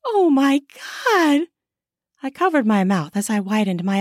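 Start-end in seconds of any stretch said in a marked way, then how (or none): 0.0-1.5 high, startled cry; shocked exclamation. Acted. Wife caught by the watching neighbor, shocked. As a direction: gasp it out higher, stretched.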